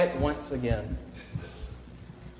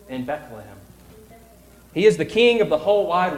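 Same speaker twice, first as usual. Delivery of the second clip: solemn reading. A narrator's reading. Reading angel's message slowly.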